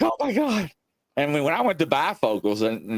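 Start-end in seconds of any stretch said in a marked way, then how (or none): 0.0-0.7 mock dismay. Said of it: "I'm like".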